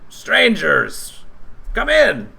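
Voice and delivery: put-on high, loud old goblin welcome. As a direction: greet strangers like a hunched old goblin. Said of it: "and he says".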